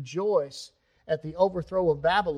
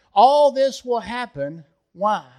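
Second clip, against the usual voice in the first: forceful preaching; 6 semitones higher, 10 dB louder than usual. Real, not acted. Preaching climax.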